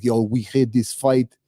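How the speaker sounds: slow, put-on Russian accent; drawn-out imitation of a Russian accent; Red Guardian Russian accent. Vlogger imitating a character recalling his old fight, slow Russian accent. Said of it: "he talks with a Russian accent".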